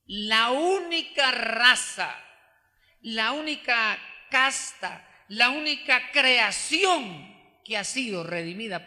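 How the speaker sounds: slow, loud, each phrase hammered; emphatic preaching. Acted. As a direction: proclaim the redeemed people, slowly.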